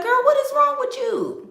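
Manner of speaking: high, indignant what is wrong with you; shocked sister. Retold or imitated. Sister confronting the missing girl, shocked and high. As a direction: shriek it at her in disbelief. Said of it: "And she was like".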